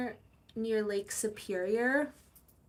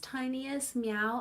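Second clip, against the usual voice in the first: ordinary talk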